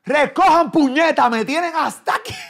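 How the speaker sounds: loud, high scolding yell; mock exasperated shout. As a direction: yell it like a fed-up parent. Imita un regaño de familia hastiada. (grito exagerado de hartazgo)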